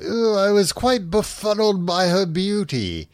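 hammed-up posh admirer; swooning Watson. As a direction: play a smitten Victorian gent, overdone. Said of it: "in a way where he's like".

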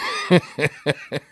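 helpless giggling at his own joke; real laughter. Real, not acted. Comedian amused by his own crude question, real giggling. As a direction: crack up after delivering the line.

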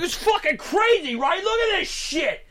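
very high, thrilled doofus voice; mock his gleeful excitement; hyped-up guy. Doofus showing off snake photo, wildly hyped. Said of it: "he was so hyped".

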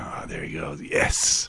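hushed unvoiced murmur; whispering. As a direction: whisper it to yourself while cooking. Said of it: "just going".

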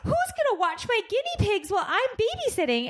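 high-pitched, overdramatic girl imitation; mock distraught daughter. Daughter refusing to leave her guinea pigs, mock distraught. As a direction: plead like a melodramatic new mother.

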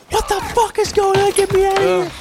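high mock woman's yell; muffled shout from closet. Host voicing her locked in the closet, high mock yell. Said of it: "She's in the closet going like".

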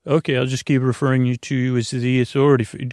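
ordinary talk

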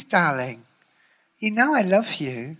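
butter him up, darling-sweet; Sharon's sweet coaxing. Retold or imitated. Sharon coaxing the writer on the phone, mild sugary voice. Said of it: "she talks to you like this".

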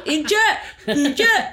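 high, loud mock yell of "Inkjet"; imitated street shout. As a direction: call it out like a man shouting up at a hotel. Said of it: "he did this low high thing".

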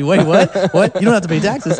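high, loud, amazed double take; stunned disbelief. Retold or imitated. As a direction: blurt it out in total disbelief. Acts out his own stunned reaction.

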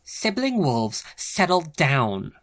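slow, firm call to settle; a leader reining in the pack; barked order. A narrator's reading. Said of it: "Genevieve barked at the crowd".

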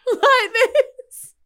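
high, cracking laugh through the words. Real, not acted. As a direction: laugh till it squeaks.

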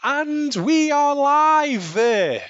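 loud, high, very slow "live" announcement; hammed-up show opener, stretched out; big announcer voice. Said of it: "You've gotta do that again".

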